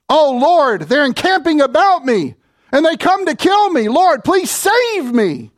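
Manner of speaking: high-pitched cry to the Lord for rescue; hammed-up prayer under attack; desperate plea. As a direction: plead urgently, drawing out the last appeal.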